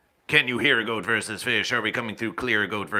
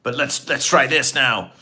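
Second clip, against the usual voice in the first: loud test; 4 semitones higher, 10 dB louder than usual. Shown as a demonstration. Testing new mic voices.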